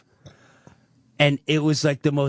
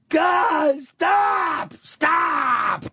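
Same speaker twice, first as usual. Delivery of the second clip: father crying stop; 13 semitones higher, much slower than usual. Retold or imitated. Son retelling his father begging the mother, high pleading shout. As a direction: call out stop like a frightened old man.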